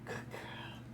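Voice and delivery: whispered praise; whispering. Real, not acted. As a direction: breathe it softly.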